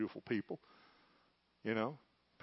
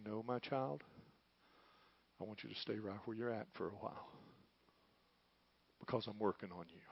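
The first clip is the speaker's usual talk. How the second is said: softer, lower, tender reply; gentle voice of God; 5 semitones lower, 9 dB quieter than usual. Retold or imitated. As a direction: soothe a hurting child. God's gentle answer voiced.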